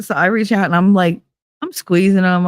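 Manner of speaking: ordinary talk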